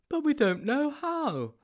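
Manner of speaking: high-pitched, naive sidekick; high storybook character voice; Sponge's character voice. A narrator's reading.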